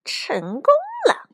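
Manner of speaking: very high, drawn-out gloating cry; gleeful triumph. Acted. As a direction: crow over a trick that worked. Weasel gloats over his trick. (得意地尖声欢呼)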